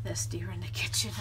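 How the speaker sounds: whispered, low and hushed; dramatic whisper. Acted. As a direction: hush it like a secret. Dramatic story detail.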